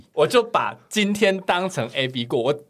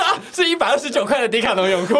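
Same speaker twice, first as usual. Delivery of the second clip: high, louder, laughing mock of the remark; mocking quote through laughter; 9 semitones higher, 3 dB louder than usual. Friend mocks the cheap Decathlon swimsuit, laughing. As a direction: giggle it out as a tease. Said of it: "他说".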